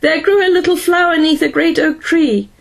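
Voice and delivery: high, sing-song robot voice; silly high-pitched machine recitation; drunken robot. A narrator's reading. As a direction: chant it high like a tipsy robot. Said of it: "his last words as he receded into the distance".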